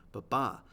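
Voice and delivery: "Ba-ba" with a light first syllable and a punched second; iambic stress demo. Shown as a demonstration. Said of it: "an unstressed syllable, then a stressed syllable".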